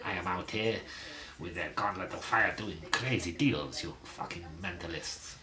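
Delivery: put-on Spanish-accented executive, amused and mocking; Raul impression. Raul mocking English fans over transfer news, Spanish accent. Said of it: "he went".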